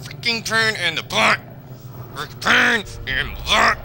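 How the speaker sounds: high, slow put-on grumbling voice; hammed-up exasperation. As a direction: exaggerate the annoyance in a silly voice. Annoyed by game, repeats complaint.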